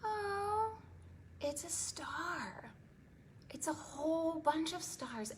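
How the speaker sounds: slow breathy whisper of wonder; awed whisper. Acted. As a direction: hush it out in awe. Awed reveal to children.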